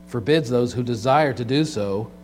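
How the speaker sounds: ordinary talk, nothing marked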